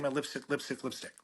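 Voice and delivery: normal talking voice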